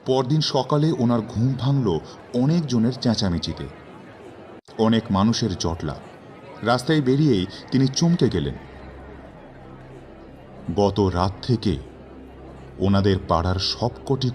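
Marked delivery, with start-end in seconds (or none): none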